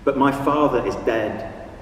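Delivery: measured poem recital with long pauses; poetry reading. Acted. Reads own poem aloud.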